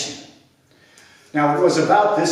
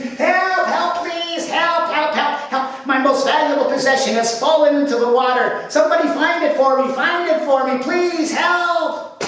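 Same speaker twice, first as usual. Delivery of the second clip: high, desperate calls for help; frantic pleading; 13 semitones higher than usual. A narrator's reading. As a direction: beg anyone to find it, voice up high. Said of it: "he screamed".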